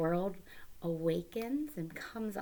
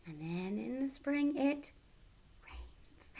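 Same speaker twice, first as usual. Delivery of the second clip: hushed; 5 semitones higher, 6 dB quieter than usual. Shown as a demonstration. Teacher hushes before the verse.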